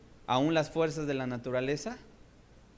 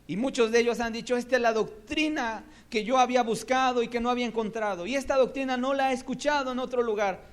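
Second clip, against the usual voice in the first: raised, fast, excited quote; eager testimony; 9 semitones higher, 5 dB louder than usual. Acted. Preacher voicing eager converts, raised and quick. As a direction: voice the seekers' delight.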